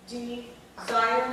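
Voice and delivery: D, then DESIRE, slow and segmented; sounding out. Shown as a demonstration. Teacher walking trainees through desire, slow sounding out. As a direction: sound out "desire" slowly and firmly. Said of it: "next sound".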